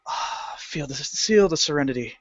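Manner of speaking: soft sighed contentment; serene relief. Acted. Clerk enjoying the quiet after the customer, serene. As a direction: breathe out and savor the calm. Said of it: "everything was nice and quiet afterwards".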